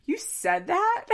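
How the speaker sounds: high, slow, stunned question; shocked disbelief. Retold or imitated. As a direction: gasp it out in disbelief. Sister shocked at Mackenzie's remark.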